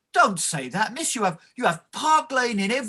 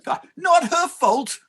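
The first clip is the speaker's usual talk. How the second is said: much higher, slow outraged disbelief; 9 semitones higher than usual. Acted. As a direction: stretch out the shocked question. Clerk outraged at defence.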